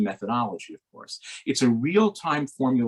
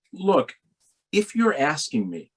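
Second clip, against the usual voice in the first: ordinary delivery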